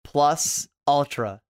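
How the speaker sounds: slow, drawn-out catchphrase Plus Ultra; drawn-out hero catchphrase. Shown as a demonstration. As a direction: stretch out "Plus Ultra" slowly like a battle cry. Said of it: "Say the line".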